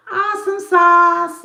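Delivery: high, slow gushing praise; playful awesome sauce; excited. Real, not acted. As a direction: squeal it out with delight. Reacting to viewer comment.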